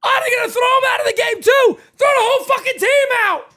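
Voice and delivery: high-pitched, fast, worked-up shouting; excited outcry. Real, not acted. Streamer reacts to players being ejected, excited high yelling. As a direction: rattle it off high over the chaos.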